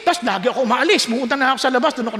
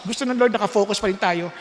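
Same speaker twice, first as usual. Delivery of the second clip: ordinary delivery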